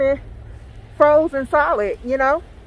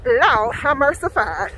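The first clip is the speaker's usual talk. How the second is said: usual speaking voice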